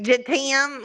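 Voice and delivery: "Je t'aime" said in a thick Southern country drawl; country Southern French. Shown as a demonstration. To her friend, mocking her own French: "Je t'aime" in a country twang. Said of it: "it comes out like".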